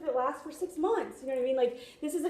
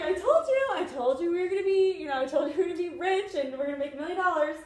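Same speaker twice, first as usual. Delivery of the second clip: high, excited, laughing retold boast; triumphant gloating; 6 semitones higher, 3 dB louder, faster than usual. Wife retelling her boast to doubting husband, excited triumph. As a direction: crow it, voice pitched up and tumbling on.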